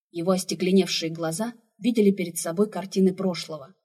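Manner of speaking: usual speaking voice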